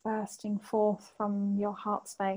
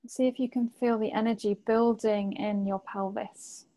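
usual speaking voice

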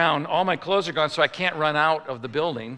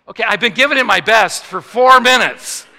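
loud, high-pitched fed-up protest; exasperated shout; 6 semitones higher, 12 dB louder than usual. Retold or imitated. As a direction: shout it back at the doctor, at the end of your rope. Acted outburst at doctor's demand.

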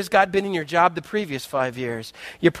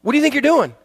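higher, louder startled whoa; 7 semitones higher, 4 dB louder than usual. Acted. Craig stops workers rushing past him, startled and loud. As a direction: pull up short in alarm. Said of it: "He's like".

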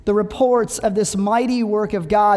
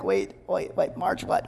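breathless unvoiced whisper, much lower and softer; stunned whisper; 6 semitones lower, 9 dB quieter than usual. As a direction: whisper it, floored by the order.

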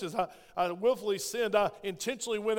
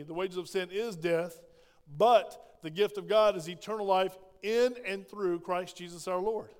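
normal talking voice

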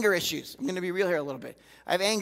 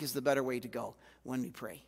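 usual speaking voice

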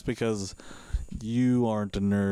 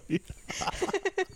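high squeaky laugh; 4 dB quieter than usual. Real, not acted. Laughing at the joke.

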